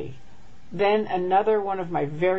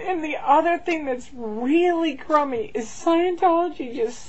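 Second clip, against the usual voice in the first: talking through tears; blubbering; 8 semitones higher than usual. Real, not acted. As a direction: still crying, voice high and strained. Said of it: "And I'm sorry I'm crying".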